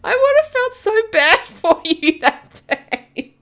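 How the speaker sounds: high, slow, pitying voice with a laugh; mock pity. To Marina about her lost bubble tea, high mock pity. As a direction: ham up the sympathy.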